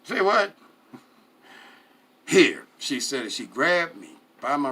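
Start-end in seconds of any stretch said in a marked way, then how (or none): 0.0-0.5 high, loud disbelieving question; confused patron blurting at bartender; baffled surprise. Retold or imitated. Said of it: "I'm like". 2.3-2.7 sharp, much louder single word; firm command. Retold or imitated. Bartender grabs narrator's wrist.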